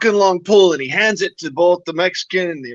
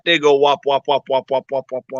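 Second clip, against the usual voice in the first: flat tire sound; 5 semitones lower than usual. Acted. Mimicking flat tire sound in joke.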